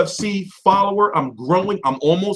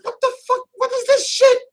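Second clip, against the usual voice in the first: much higher squeaky disbelief; retold disbelief. Streamer imitating his own reaction, squeaky disbelief. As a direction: go way up in mock shock. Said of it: "was like".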